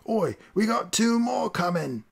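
slow, high calling voice of a deckhand; passenger calling out. Acted. Passenger aboard the airship alerting the crew, slow high call. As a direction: call the news back over your shoulder. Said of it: "One of the passengers aboard the Talos heard him".

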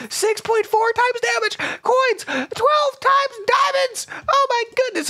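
high, slow stunned reading; amazed squeal. Real, not acted. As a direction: marvel at every number, way up high.

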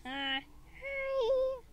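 put-on very high, soft baby squeak; teeny tiny baby voice. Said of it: "Teeny tiny baby voice".

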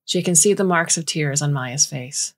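ordinary delivery, nothing marked

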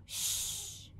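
long unvoiced shh; shushing sound. Shown as a demonstration. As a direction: hush them with a soft shh. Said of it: "faz o som do silêncio".

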